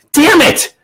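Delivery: sudden exasperated yelp at a flub; frustrated outburst. Pretend. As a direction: blurt it high in frustration. Curses own flubbed line.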